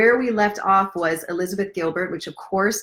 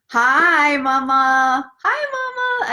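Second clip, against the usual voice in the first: a bright, lilting hello pitched way up; high sing-song greeting; 8 semitones higher, 4 dB louder, much slower than usual. Real, not acted. Greeting someone who joined.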